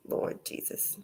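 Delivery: breathy whispered exclamation. Real, not acted. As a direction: whisper it to yourself.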